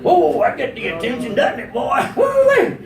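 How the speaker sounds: whooping Louisiana exclamation; hammed-up Louisiana glee; Louisiana psychic. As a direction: holler in Louisiana style, ending on a high whoop. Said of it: "she'd be like a Louisiana psychic".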